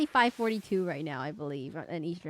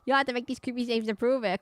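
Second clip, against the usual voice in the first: normal talking voice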